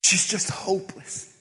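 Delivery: a whispered line, barely voiced; fluisterend. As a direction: breathe it out as a whisper. Voicing mother's despair, whispered.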